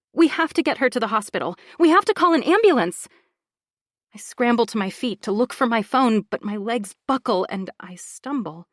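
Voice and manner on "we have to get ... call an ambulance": fast, rising into a loud high cry; frantic urgency. Acted. Laura frantic to get her child to hospital. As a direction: rush it out in panic.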